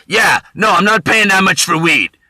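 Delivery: a guy yelling a weed deal down the phone. Retold or imitated. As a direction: bark it into a phone. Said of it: "yelling on the phone".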